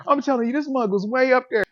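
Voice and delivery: laughing through the words. Real, not acted. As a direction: laugh while you tell it.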